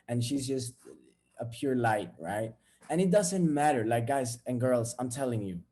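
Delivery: usual speaking voice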